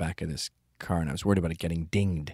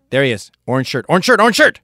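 yelling louder and louder, pointing him out; shouting out; 6 semitones higher, 15 dB louder, faster than usual. Pretend. Spotting the target from the car, building shout. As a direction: build to a full yell. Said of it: "and I wanna say".

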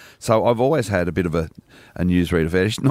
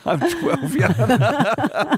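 put-on high, laughing kid voice; young boy voice; much slower than usual. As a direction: say it like an eager twelve-year-old boy, giggling. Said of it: "sometimes I'd answer back".

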